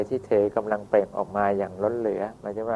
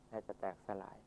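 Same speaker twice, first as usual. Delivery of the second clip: a near-silent breath of the phrase; hushed echo; 2 semitones lower, 25 dB quieter, faster than usual. A narrator's reading. Teller softly echoes the heartbreak, barely audible. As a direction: let it fade almost to nothing.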